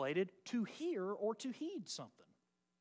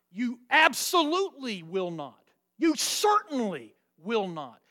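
"absolutely" and "certainly" punched loud; forceful emphasis; 6 dB louder than usual. Shown as a demonstration. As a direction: hammer "absolutely" and "certainly", then a firm "will not". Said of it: "a practice of throwing the emphasis".

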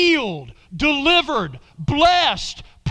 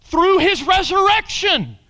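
high, loud, fervent preaching; full-force preaching; 9 semitones higher, 7 dB louder, slower than usual. Acted. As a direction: preach it out to the whole room.

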